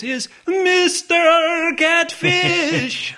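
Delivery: high, drawn-out announcer call; hype announcer. Acted. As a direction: announce him like a game show host. Announcing co-host on podcast.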